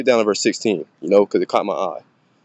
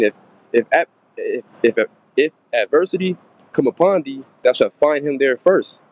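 scripture reading; 3 semitones higher than usual. Acted. Reads scripture aloud.

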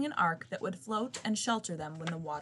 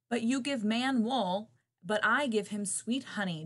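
ordinary delivery

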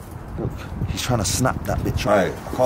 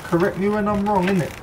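mock high correction; 8 semitones higher than usual. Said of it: "I'm joking, bruv".

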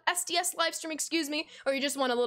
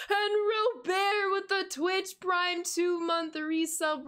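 hype announcer; 6 semitones higher, slower than usual. Acted. Hyping a sub announcement.

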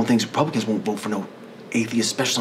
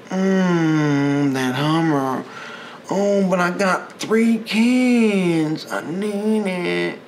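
mock Republican; 7 semitones higher, slower than usual. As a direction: play a whiny selfish truck owner. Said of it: "They're like".

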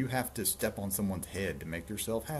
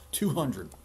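kingly demand; 5 semitones higher, 6 dB louder than usual. Acted. King's demand, first take.

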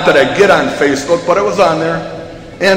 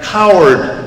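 milder echoed call; echo coming back. A narrator's reading. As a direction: repeat the shout as a distant echo. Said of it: "coming back".

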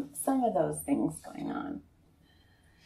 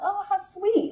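high, bright gush over a gift; delighted high coo; 13 semitones higher, 4 dB louder, slower than usual. Retold or imitated. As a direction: coo it like a sweet surprise. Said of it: "And I was like".

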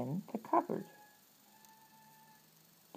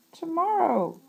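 high, loud, stubborn wail; cried out; 12 semitones higher, 8 dB louder than usual. Acted. Toad crying out to Frog, won't do chores: loud high wail. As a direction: fling the word out, refusing. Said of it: "cried Toad".

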